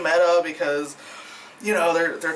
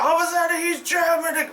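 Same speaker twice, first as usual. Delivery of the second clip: much higher, botched mock German accent; bad German accent; 10 semitones higher than usual. Reviewer mocking the actor's slipping accent, badly faked German. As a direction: put on a hammy, failed German accent. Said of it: "Well, no, that's not German. That's Eddie Izzard doing a German accent".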